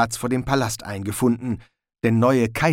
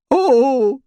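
much higher, small startled oh; Rincewind, dismayed; 13 semitones higher, 7 dB louder than usual. A narrator's reading. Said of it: "sagte Rincewind".

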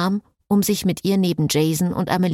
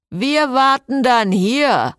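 higher, louder and slow, called after them; calling after them; 8 semitones higher, 5 dB louder, slower than usual. Acted. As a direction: call it out after the departing couple. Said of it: "rief ihnen Jason nach". (laut und langsam hinterhergerufen)

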